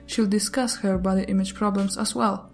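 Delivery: normal talking voice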